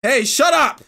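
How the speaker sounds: loud, high snap at the dog; loud high shout to silence the dog; angry yell. Acted. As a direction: tell the dog off loudly. Yelling at the noisy dog.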